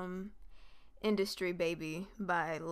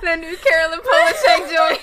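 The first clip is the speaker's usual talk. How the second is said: loud laughing shout; 9 semitones higher, 15 dB louder than usual. Real, not acted. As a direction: shout it while cracking up. Echoing friend, cracking up.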